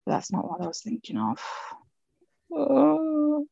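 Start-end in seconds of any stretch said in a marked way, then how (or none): none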